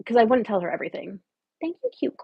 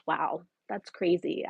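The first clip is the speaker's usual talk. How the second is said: ordinary talk, nothing marked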